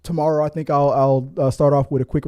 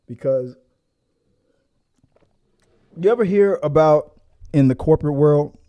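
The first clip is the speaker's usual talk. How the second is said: usual speaking voice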